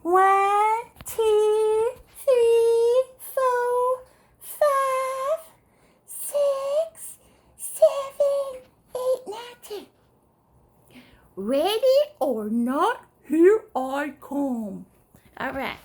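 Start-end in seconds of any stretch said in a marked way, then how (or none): 0.0-9.9 high, chanted count; drawn-out playful counting; hide-and-seek counting. Pretend. 11.4-14.9 slow, drawn-out announcement; sing-song warning to hiders; playful seeker's call. Pretend. Seeker calls out in hide-and-seek.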